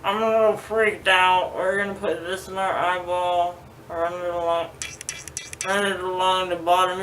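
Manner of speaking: ordinary delivery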